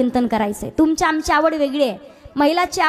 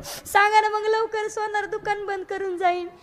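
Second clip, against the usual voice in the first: pleading wife; 5 semitones higher, 6 dB quieter than usual. Acted. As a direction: coax him gently to hurry. Wife urges husband to hurry.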